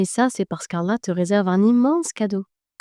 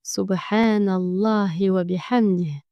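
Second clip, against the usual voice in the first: slow prayer recitation; slower than usual. Shown as a demonstration. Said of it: "Et dis".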